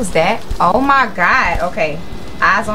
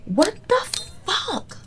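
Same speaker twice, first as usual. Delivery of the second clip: high, much softer, slow shocked breath; stunned hush; 11 semitones higher, 8 dB quieter, much slower than usual. Real, not acted. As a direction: gasp it out in disbelief. Shocked by game event.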